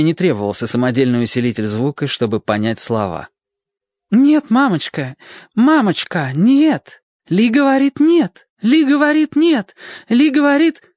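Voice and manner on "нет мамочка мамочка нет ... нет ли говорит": put-on high, loud, frantic young wife; Marina pleading. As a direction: beg desperately in broken English. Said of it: "Марина затараторила".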